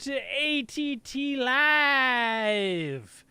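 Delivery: high, drawn-out announcer welcome; show-opening announcer. Real, not acted. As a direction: stretch the show's name out in a long, falling holler. Host opens the live show.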